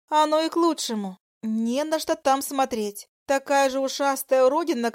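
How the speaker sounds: spiteful mistress. Acted. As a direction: sneer at the rival wife. Voices the dragoness mistress.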